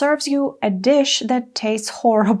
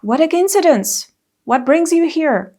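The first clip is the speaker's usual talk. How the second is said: higher, bright delighted surprise; pleased surprise; 6 semitones higher than usual. Shown as a demonstration. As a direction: greet a friend with happy surprise. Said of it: "express your surprise better".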